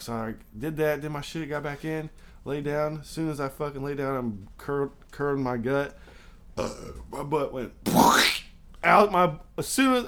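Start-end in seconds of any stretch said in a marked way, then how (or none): none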